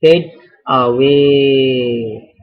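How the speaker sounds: fades away, stretched very slowly; drawn-out dictation. Real, not acted. Teacher writing out the answer for students, slow drawn-out dictation. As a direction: draw each word right out as if writing it.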